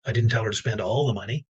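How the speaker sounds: "all" stressed with a rise. Shown as a demonstration. The next person on stage stressing "all". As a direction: lean on "all". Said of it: "emphasize a different word".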